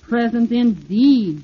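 slow, sneering jeer; mock him scornfully; sneering tower jeers. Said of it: "jeered the willowy water tower".

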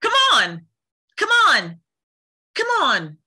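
high, loud urging; urgent game-heroine call. Acted. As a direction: call it high with a falling end. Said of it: "could you also say".

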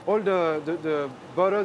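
ordinary talk, nothing marked

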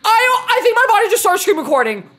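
high, loud rush after a scare; freaked out and getting trigger-happy; shaken outburst. Real, not acted. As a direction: rant in high-pitched shock. Reacting to jump scare.

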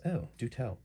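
lightly English, intrigued reply; Lalandra's British accent. Acted. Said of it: "hint of a British accent".

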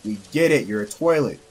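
normal talking voice

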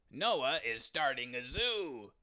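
mocking, snickering giants. A narrator's reading. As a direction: jeer with a smothered laugh. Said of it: "snickered the giants".

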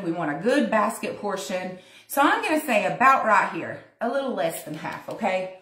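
ordinary talk

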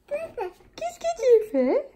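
very high, slow sing-song with a laugh. Real, not acted. Mother teasingly repeats her question to her son, high slow cooing.

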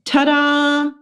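high showy Ta-da. Acted. Miranda revealing her costume, high showy ta-da. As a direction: present yourself with a flourish. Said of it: "she said".

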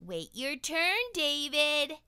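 high, loud stern teacher voice; scolding teacher. Acted. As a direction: scold him like a strict teacher. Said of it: "David's teacher always said".

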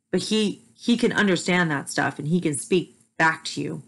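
usual speaking voice

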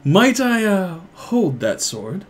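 high, drawn-out polite request; theatrically polite, lingering question; courteous stranger. Acted. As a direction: ask delicately, as an intrigued stranger. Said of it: "He's like".

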